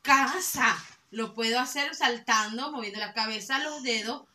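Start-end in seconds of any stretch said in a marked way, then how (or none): 0.0-0.8 casa loud and forceful, CAA-sa; casa with a big held first syllable; exaggerated stress. Shown as a demonstration. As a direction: punch out casa for the class. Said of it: "puedo hacerlo".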